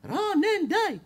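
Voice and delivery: high pitched angry yelling at Ronen; high exasperated neighbor's yell; mock yell. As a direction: shout him down, fed up. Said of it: "שעה צעק עליי".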